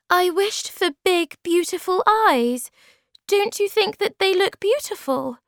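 very high, loud little creature voice; high-pitched storybook maiden, pleased with herself; hopeful Snork Maiden. Acted. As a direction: pipe it up high, asking for admiration.

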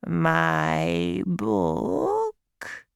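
drawn-out high creature voice; slow, wondering fairy question. A narrator's reading. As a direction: stretch both words out slowly as an old fairy. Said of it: "the voice said slowly".

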